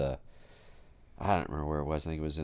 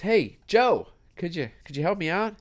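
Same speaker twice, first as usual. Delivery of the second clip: much higher, louder hail to a friend; calling out for help; 14 semitones higher, 6 dB louder than usual. Retold or imitated. Man in hole calls up to his friend Joe, high loud hail. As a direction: shout up from the hole, hopeful.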